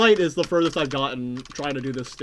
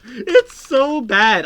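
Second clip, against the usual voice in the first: cringing groan; 8 semitones higher, 6 dB louder, much slower than usual. Real, not acted. Cringing at video.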